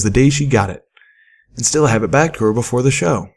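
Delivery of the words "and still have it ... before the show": ordinary delivery, nothing marked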